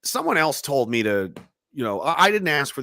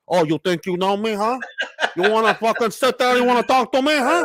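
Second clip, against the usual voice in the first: higher, louder tough-guy taunt, laughing; mock gangster; 10 semitones higher, 4 dB louder than usual. Imagined taunt to the TV reporter, hammed-up gangster bravado. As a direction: taunt them like Pablo Escobar with a cigar. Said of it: "I'm like".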